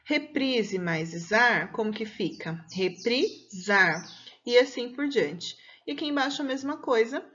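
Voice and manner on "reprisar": very slow "Reprisar", broken into parts; sounded-out answer. Shown as a demonstration. Teacher answering how the verb ends up, slow and deliberate. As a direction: stretch "Reprisar" out in separate pieces.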